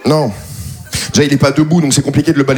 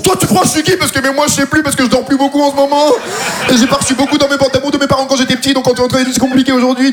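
higher, fast, half-serious confrontation; higher, rushed bluster; mock angry rant; 6 semitones higher than usual. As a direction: rant then ramble at the neighbour. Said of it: "pourquoi on pose cette question dans les embrouilles".

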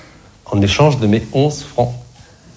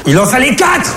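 exclamation haut perchée; lâcher la chute dans un cri aigu; chute exclamée; 12 semitones higher than usual. Acted. Chute du sketch, criée. (cri dépité et aigu)

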